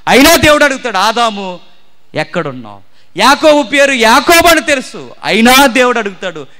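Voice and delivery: loud, high impassioned sermon; fervent preaching. Acted. Preacher urging confession, loud impassioned preaching. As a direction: hammer the point at the congregation.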